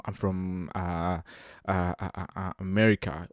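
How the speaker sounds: ordinary talk, nothing marked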